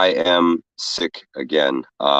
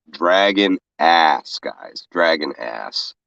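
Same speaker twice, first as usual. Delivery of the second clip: sick and drained; 2 semitones lower, much slower than usual. Real, not acted. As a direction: drag the words, exhausted. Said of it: "I felt like I was gonna fucking die".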